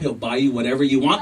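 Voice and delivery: ordinary delivery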